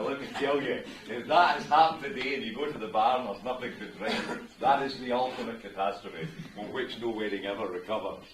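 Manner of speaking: normal talking voice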